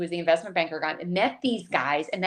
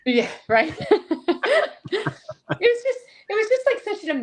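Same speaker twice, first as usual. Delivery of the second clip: real laugh, much higher, spilling into the words; 14 semitones higher than usual. Real, not acted. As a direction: talk through the giggles. Laughing at the bonus joke.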